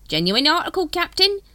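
high, loud cockney-ish hawker; street hawker voice. Acted. Dibbler greets the Captain, high hawker voice. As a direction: sidle up like a spiv.

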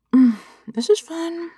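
talking through a mouthful of banana; mouth full. Acted. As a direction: chew while saying it. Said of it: "I'm supposed to talk with my mouth full".

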